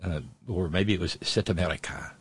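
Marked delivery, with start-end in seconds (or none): 1.2-2.1 soft, low mock French accent; bad French accent. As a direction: say it like a French exchange friend, half-hearted. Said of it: "Sorry for the bad French accent".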